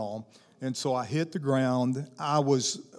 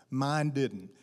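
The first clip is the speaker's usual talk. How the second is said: ordinary talk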